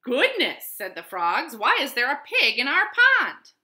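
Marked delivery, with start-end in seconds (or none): 0.0-0.7 high, loud exclamation of surprise; startled. A narrator's reading. Frogs finding a pig in their pond, startled. 1.5-3.5 high, loud baffled question; astonished. A narrator's reading. Frogs finding a pig in their pond, astonished.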